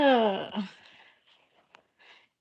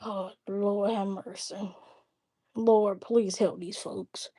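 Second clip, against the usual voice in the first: soft, slow exasperated plea; weary prayer; 3 semitones lower, 9 dB quieter, slower than usual. Real, not acted. Asking the Lord to help these folks, soft slow plea. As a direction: pray under your breath.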